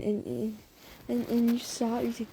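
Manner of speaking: usual speaking voice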